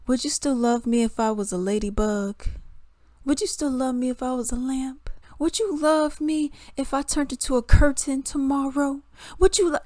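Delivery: put-on wheedling, sillier with each question; needy girlfriend. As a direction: imitate a woman pestering her man with silly questions. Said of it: "a woman being like to her man".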